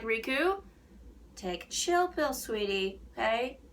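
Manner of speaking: coaxing a character to calm down; light mock-soothing "sweetie"; a playful older-sister tone; teasing scold. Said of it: "And I'm like".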